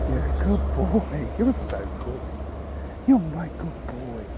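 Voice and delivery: slow, soft praise for a good dog; tender dog praise. Real, not acted. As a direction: coo it gently to the dog. Praising the dog after sit.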